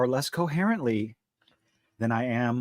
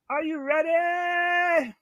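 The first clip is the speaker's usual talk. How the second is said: much higher, slow, drawn-out announcer call; ring announcer hype; much slower than usual. Pretend. Hyping his cohost like a wrestling announcer, drawn-out call. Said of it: "Ladies and gentlemen".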